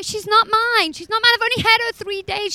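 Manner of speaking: high, loud embarrassed plea; imagined protest. Retold or imitated. Mother to staring shoppers, high loud flustered denial. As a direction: blurt it out high and loud, wanting to disown her. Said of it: "And I wanna say".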